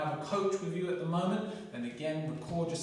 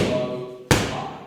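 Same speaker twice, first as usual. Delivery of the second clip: boxing coach calling combos; 9 dB louder than usual. Shown as a demonstration. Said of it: "calling target levels during drill".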